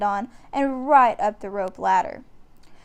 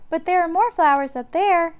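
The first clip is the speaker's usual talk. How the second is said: put-on much higher, louder eager girl; young girl offering more; little girl; 8 semitones higher, 7 dB louder than usual. A narrator's reading. Annie telling the dinosaur about flowers, high girlish voice. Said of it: "Annie said".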